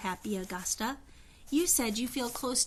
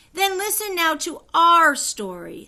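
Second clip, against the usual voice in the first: high bell-like voice; 7 semitones higher, 10 dB louder than usual. A narrator's reading. Queen Anne addressing Augusta, high and ringing. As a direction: ring out clear like a bell. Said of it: "Her high, clear voice rang like a bell".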